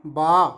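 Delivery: loud, low, long baa; demonstrated sheep bleat. Shown as a demonstration. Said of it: "शिप का sound".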